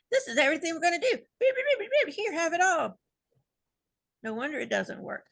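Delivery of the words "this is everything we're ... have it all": much higher, fast, whiny rush with button beeps; mock frantic Dean. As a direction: parody Dean punching it all in at top speed, near tears. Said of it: "and Dean's like".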